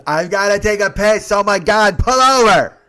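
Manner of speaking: high, very loud mock outburst; urgent shout. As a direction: imitate a desperate passenger yelling, building. Retold passenger shouting to stop.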